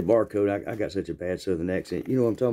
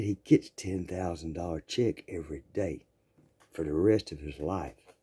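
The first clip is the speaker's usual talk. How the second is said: confiding low aside; 3 semitones lower, 6 dB quieter than usual. Retold or imitated. Confiding brag to the girls.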